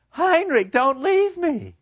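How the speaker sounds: put-on high, distraught girl pleading; a broken young woman begging her lover; Gretchen's high voice. To her lover, Gretchen gone mad with grief, high and pleading.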